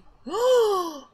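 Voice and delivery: high sharp delighted gasp; big gasp. Real, not acted. Player discovering a curse spell, gasping.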